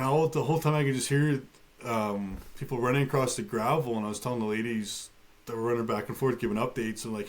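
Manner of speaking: usual speaking voice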